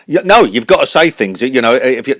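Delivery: very high, loud cartoon host voice; radio host voice. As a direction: announce the show like a squeaky showman.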